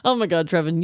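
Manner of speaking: ordinary talk, nothing marked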